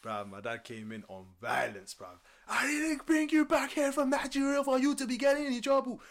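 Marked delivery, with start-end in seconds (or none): 2.5-6.0 angry dad. Retold or imitated. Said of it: "He screamed my head off".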